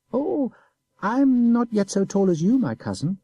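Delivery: put-on high, innocent boy's voice; simple child. A narrator's reading. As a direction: play the guileless little boy. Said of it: "replied the child with a simple air".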